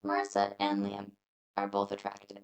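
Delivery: deadpan robot reading a line. Shown as a demonstration. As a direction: drone it out like a machine. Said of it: "We sound like such a robot".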